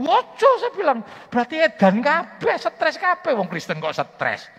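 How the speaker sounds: very high, loud outburst; shouted retort. Retold or imitated. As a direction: bellow the rebuke. (teriakan keras)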